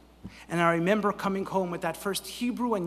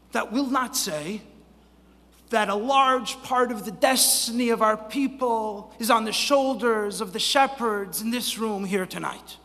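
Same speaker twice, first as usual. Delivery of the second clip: louder, raised-pitch oratory; impassioned preaching; 5 semitones higher, 5 dB louder than usual. Real, not acted. Speaker honoring the shepherds in this room tonight, impassioned preaching. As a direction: drive it home to the whole room.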